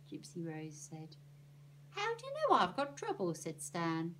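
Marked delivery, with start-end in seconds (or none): 1.9-3.3 high, startled question; surprised boy. Acted. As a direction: caught off guard, voice jumps up. Boy startled by fortune teller.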